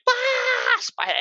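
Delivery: mock panicked shriek cut off mid-word, very high; imitating a startled high shriek; shrill scream. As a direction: start screaming spider and break off. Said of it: "dude screamed".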